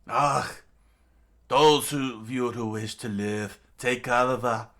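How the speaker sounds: loud, commanding villain shout; Crocodile screaming. Acted. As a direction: bellow a warning to the rabble. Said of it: "Crocodile screamed out as he jumped".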